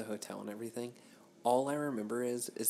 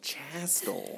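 whispered disbelief; 4 semitones higher than usual. Real, not acted.

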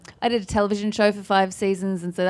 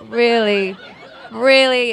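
louder, laughing disbelief; disbelieving; 4 semitones higher, 7 dB louder, much slower than usual. Retold or imitated. Fans doubting she's Australian, loud laughing disbelief. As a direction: repeat it like you can't believe your ears. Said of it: "They're like".

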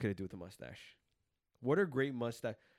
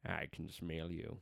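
low, slow, bad narrator voice; a deliberately bad deep narrator voice, very slow; Morgan Freeman impression; 6 semitones lower, 6 dB quieter, much slower than usual. Asked by a friend for a Morgan Freeman impression, low and slow. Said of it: "No, that's terrible".

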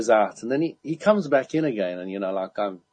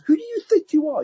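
very high, louder outraged mimicry; mock angry indignation; 5 dB louder than usual. The guy challenging him in the sauna, mock angry. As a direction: imitate an affronted man, pitched right up. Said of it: "he did it so angrily".

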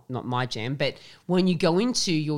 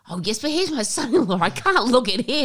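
higher, laughing coy mum voice; 6 semitones higher, 4 dB louder than usual. Retold or imitated. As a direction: protest bashfully, giggling. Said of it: "She goes".

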